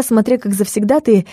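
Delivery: ordinary delivery, nothing marked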